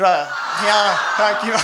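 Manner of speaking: hammed-up disgust; outraged punchline. Real, not acted. Comedian telling the crowd about the gum, loud and outraged.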